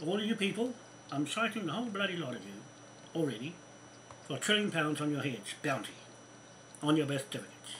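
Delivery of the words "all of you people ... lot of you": ordinary talk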